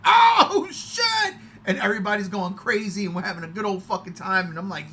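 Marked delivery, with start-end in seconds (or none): 0.1-1.3 high loud oh shit; excited crowd yell; crowd shout. Retold or imitated. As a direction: yell like a stunned theater. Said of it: "everybody's like".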